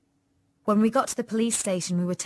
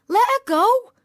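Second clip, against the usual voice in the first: high, loud fierce demand; fierce shout to free a friend; 12 dB louder, slower than usual. A narrator's reading. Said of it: "said Tanya fiercely".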